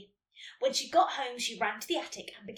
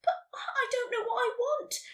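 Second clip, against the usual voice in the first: high-pitched plaintive whine; almost in tears; 10 semitones higher than usual. A narrator's reading. Nelly unsure what to write, almost in tears. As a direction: plead high, on the edge of tears. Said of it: "almost in tears".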